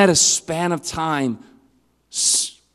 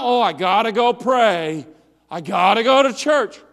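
mock reluctance; 6 semitones higher, 3 dB louder than usual. Mocking a reluctant believer.

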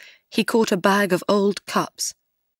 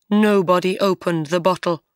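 ordinary delivery

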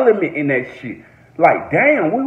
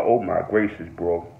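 normal talking voice

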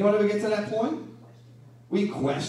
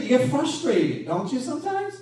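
ordinary talk

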